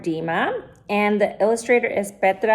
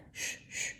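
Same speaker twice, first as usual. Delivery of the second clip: swishing water sound; 19 dB quieter than usual. Acted. Coyote swimming through water.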